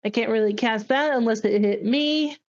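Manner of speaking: ordinary talk, nothing marked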